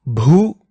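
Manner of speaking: "Bhu" with a clear, strong puff of breath; emphatic aspirated "Bhu"; clear forced bh. Shown as a demonstration. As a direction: push breath through the Bh of "Bhu". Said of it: "should be made clear".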